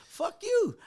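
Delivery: high-pitched mock-angry comeback; retold defiant retort. Teller playing the DJ who won't punk out, retold defiant retort. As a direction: throw it back hard and high, acting out the row. Retold quarrel, Dragon answers back.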